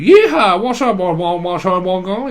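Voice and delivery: a big high whooping cowboy holler; cowboy yeehaw. Pretend. Reader declares herself all country, then whoops loudly. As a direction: belt it out like a rodeo rider. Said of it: "I'm all country".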